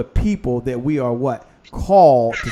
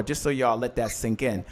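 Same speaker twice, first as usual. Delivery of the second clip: normal talking voice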